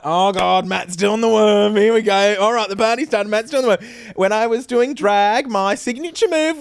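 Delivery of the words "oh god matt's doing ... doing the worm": mock groan. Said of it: "it was like".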